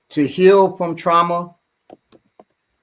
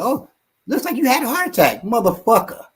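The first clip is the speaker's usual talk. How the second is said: high, fast, sarcastic doctor imitation; mocking voice; 10 semitones higher than usual. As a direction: sneer it like careless staff. Mocking hospital staff.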